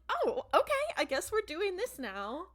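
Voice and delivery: weirded out. Retold or imitated. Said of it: "Dean is weirded out".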